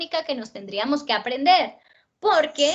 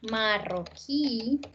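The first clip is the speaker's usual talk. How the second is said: "Marroquí" slow and soft, final QUÍ held; stress on final syllable; 6 dB quieter, much slower than usual. Shown as a demonstration. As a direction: stretch "Marroquí" out toward its last syllable. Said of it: "Tienen el acento en la última sílaba". ("Marroquí" lento, alargando la sílaba final)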